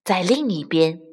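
plain reading voice

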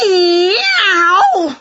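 loud high long meow; a cat cutting in; interrupting meow. A narrator's reading. Wilbur interrupting Winnie, loud high meow. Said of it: "interrupted Wilbur".

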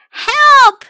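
high, loud panicked cry of Help!; a trapped tiger shouting up out of the pit. Acted. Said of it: "cried Tiger".

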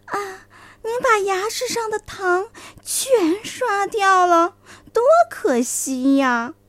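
high, slow, wheedling fox; sly fox voice. A narrator's reading. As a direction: feign pity over lost sugar. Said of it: "狐狸来了". (狐狸假惺惺地惋惜)

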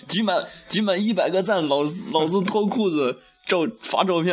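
high-pitched laughing boast. Pretend. Laughing boast about collecting likes.

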